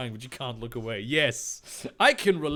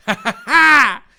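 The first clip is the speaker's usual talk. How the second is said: high, forced evil cackle; staccato mock-villain laugh, very loud; 9 semitones higher, 13 dB louder than usual. Jack laughs at the trapped heroes, loud fake cackle. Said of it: "Jack laughed more and disappeared".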